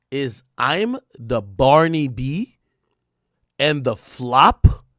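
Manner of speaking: slow, drawn-out questioning read; slow dramatic read. Acted. Reading out Nicki's post to viewers, slow and dramatic. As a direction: spell it out slowly, word by word.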